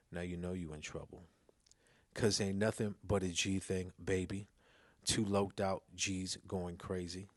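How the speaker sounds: ordinary delivery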